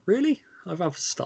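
high-pitched retold disbelief; surprised reply. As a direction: play mock astonishment at the compliment. Acts out own surprised reply.

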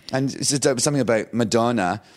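ordinary delivery

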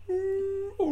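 long moaning whale song; imitated whale call. Host mimics whales conversing in the background, as moaning calls. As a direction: make a drawn-out whale noise. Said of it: "in the background you just".

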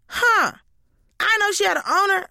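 put-on high voice of the neighbor; neighbor's voice. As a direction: imitate a neighbor taken aback. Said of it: "neighbor goes".

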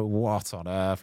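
slow, drawn-out amazed question; a slow, hushed what on earth; stunned disbelief. Retold or imitated. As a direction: let the shock sink in slowly. Reacting to transfer news.

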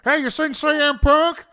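mock Larry the Cable Guy; Larry impression. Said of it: "Larry the Cable Guy was like".

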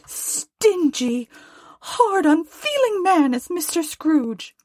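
very high, scolding wife; high, exasperated wifely tirade; indignant Mrs Cratchit. Acted. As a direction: rail about him in a high-pitched voice. Voices Mrs Cratchit railing at Scrooge.

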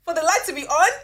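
loud, high-pitched disbelief; hammed-up shocked questioning; mock incredulity. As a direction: exclaim as if just finding out. Mocking her younger, clueless self.

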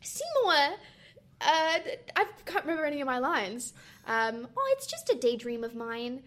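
a hint of Audrey's squeaky accent; Audrey character voice. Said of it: "give us some of your character voice".

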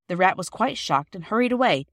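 ordinary delivery

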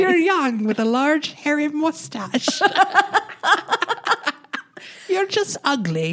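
high-pitched, hammed-up Irish accent; bad Irish accent. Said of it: "my Irish accent is not even".

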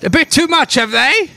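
high, gushing camp diva voice; campy drag queen. Acted. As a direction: greet them like a flamboyant drag queen. GM voicing Mother Appleby.

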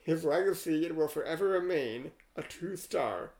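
rhythmic verse reading, lines leaning hard on their openings; poem recitation. Acted. Host reading an AI poem about Yul Brynner, measured recitation. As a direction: recite a rhyming tribute line by line. Reads the rhyming poem aloud.

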